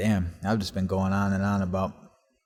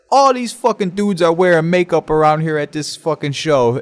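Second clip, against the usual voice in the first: loud, raised argumentative retelling; indignant protest; 8 semitones higher, 10 dB louder than usual. Retold or imitated. Teen argues with guard about makeup, indignant protest. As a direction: argue it out loudly and high.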